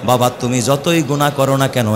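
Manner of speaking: normal talking voice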